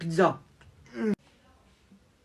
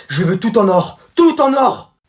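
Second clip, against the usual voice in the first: loud, higher shouted demand; demanding prince; 5 semitones higher, 12 dB louder, slower than usual. Acted. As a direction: yell like a spoiled prince. Prince exige. (prince capricieux qui crie ses ordres)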